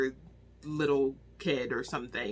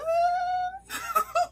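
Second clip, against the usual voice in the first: high, loud disbelieving burst of laughter; shocked laugh; 4 dB louder than usual. Real, not acted. Reviewer reacting to a brutal scene, shocked high laugh.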